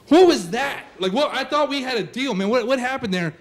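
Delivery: high-pitched, incredulous demand; appalled confrontation. Acted. Lifeguard confronting a kid after a beating, appalled.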